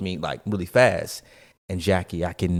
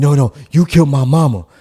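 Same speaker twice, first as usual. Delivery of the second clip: accusing shout; 5 semitones higher, 8 dB louder than usual. Retold or imitated. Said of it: "man accusing again".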